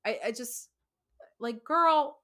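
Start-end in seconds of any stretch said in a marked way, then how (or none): 1.6-2.2 exasperated. Retold or imitated. Mocking the angry husband.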